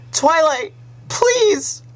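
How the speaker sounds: very high desperate screaming; terrified plea. Acted. Sunset slipping from Twilight's grip, screaming in terror. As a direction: scream her name, pleading.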